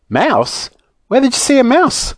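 startled question leaping high; mild alarm, pitch jumping up on the question. Acted.

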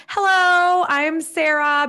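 high, eager hello; bright greeting. Retold or imitated. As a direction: introduce yourself, extra chipper. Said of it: "I was like".

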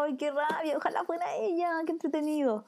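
acted good-natured envy; light-hearted envy. Shown as a demonstration. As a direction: lift the voice into playful longing. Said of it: "el tono de voz ya le cambia el sentimiento".

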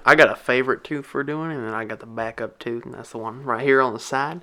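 mock Southern country accent. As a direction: play a folksy country bumpkin. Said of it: "rest of the podcast with country accents".

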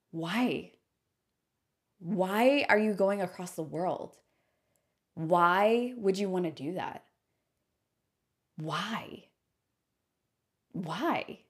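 imitating a skeptical friend; spaced-out questions with long pauses, lightly mocking; mildly disapproving. Friend questioning the trip across the world, mildly disapproving.

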